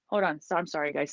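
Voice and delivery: normal talking voice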